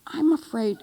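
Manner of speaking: gently indulge your mother, lightly imitated; a touch of tender daughter calling her mother; sweet adult-child voice. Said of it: "Katie said in this voice".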